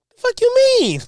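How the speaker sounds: high-pitched scornful question; incredulous outrage. Real, not acted. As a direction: throw it back in high disbelief. Scoffing at the clip's claim.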